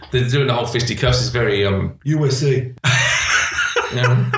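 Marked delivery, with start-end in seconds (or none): 2.8-3.9 real shrieking laugh; high squeal of a laugh; high burst of laughter. Real, not acted. Friend shrieking with laughter at a joke.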